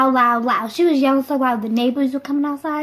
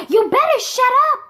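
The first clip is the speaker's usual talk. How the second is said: high-pitched scolding yell; mock a mother calling out a warning; imitated angry mom; 10 semitones higher than usual. Mom warning a crying child from her room, mock angry. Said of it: "my mom was like".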